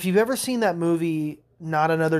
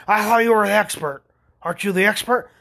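higher, louder mock indignation; indignant customer; 5 semitones higher, 6 dB louder than usual. Customer challenges the man fixing it, mock indignant. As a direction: challenge them like an affronted customer.

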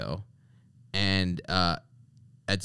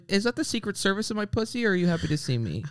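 higher-pitched mock mom; imagined mom's voice; 10 semitones higher, 4 dB quieter than usual. Host imagining the mom's reaction to the president, flirty and mocking. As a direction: lightly voice a flirty woman, pitched up. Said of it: "she's like".

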